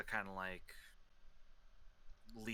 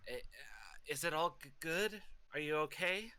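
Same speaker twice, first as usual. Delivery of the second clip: calling down; 7 semitones higher than usual. Acted. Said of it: "is gonna call down".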